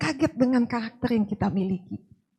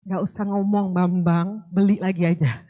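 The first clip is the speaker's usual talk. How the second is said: lower, contained version of a scolding; restrained response; 5 semitones lower than usual. Acted. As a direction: hold the temper back, keep it low. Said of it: "Kalau saya sekarang saya cuma bilang". (menahan marah, nada rendah dan terkendali)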